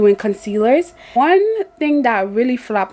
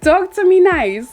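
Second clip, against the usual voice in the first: laughing; 8 semitones higher, 3 dB louder than usual. Retold or imitated. Repeating the sassy comeback, laughing.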